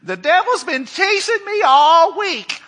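high, put-on whiny voice of a church member; complaining churchgoer. People coming to church whining about the devil, high mock complaint. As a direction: mimic a worn-out believer moaning.

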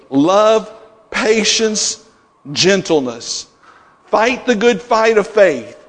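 preacher reads First Timothy to the church, fervently; preaching scripture. Acted. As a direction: read the verse like a call to arms. Scripture read with rising fervor.

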